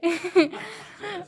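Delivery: high giggle after a self-plug; real giggle. Real, not acted. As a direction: laugh lightly at yourself. Laughs after plugging herself.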